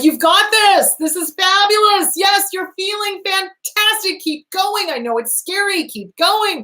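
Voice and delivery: high, upbeat cheerleader encouragement; cheerleading. Shown as a demonstration. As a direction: pump the client up, high and eager. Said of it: "I cheerlead them".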